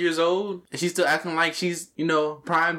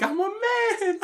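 very high, hammed-up announcement; mock big reveal; much slower than usual. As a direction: squeal the confession for laughs. Acting out the shock reveal.

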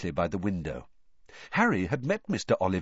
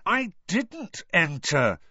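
irritated; 9 semitones higher, 4 dB louder, slower than usual. A narrator's reading. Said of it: "starting to feel irritated".

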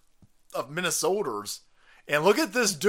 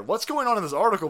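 normal talking voice

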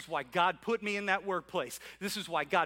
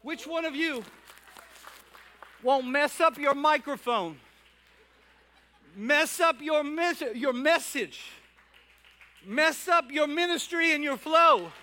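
higher, louder, building preacher's cry; pastor's rising call, louder and higher; full-force preaching; 7 semitones higher, 10 dB louder than usual. Acted. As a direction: lift the room, preach it out full voice.

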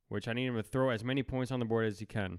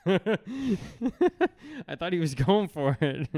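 laughing; 5 semitones higher, 5 dB louder, much slower than usual. Real, not acted. Laughing at a friend's pick.

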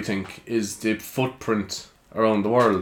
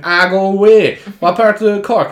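loud, high "go away" of delight; mock delighted disbelief; 7 semitones higher, 7 dB louder than usual. Clubgoer finding out he's from Cork too, delighted. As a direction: sound thrilled to meet a neighbour.

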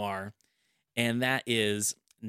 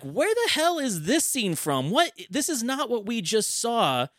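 baffled disbelief; 7 semitones higher than usual. Retold or imitated. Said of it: "And I was like".

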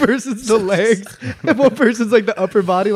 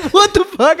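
high, loud disbelieving outburst; shocked retort; 12 semitones higher, 8 dB louder than usual. Retold or imitated. Reacting to the guy from Kansas, loudly shocked. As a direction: blurt it out, stunned. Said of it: "And I was like".

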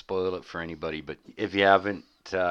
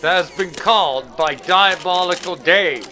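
higher, louder breathless field reporter; news reporter; 7 semitones higher, 6 dB louder than usual. Acted. Reporter covering the villain's press conference, breathless. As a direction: report it live from the scene.

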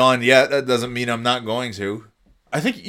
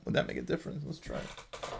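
whispering; 11 semitones lower, 14 dB quieter, faster than usual. Real, not acted. Whispered aside while yawning.

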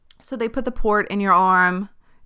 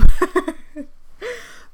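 high burst of laughter; 11 semitones higher, 8 dB louder than usual. Real, not acted. Burst of laughter after repeat.

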